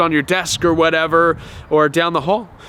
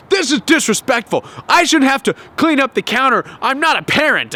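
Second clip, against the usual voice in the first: indignant narcissist; 8 semitones higher than usual. Retold or imitated. Narcissist complaining about spilt milk left on counter, indignant. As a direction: rant like you're fed up. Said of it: "You can hear the narcissist".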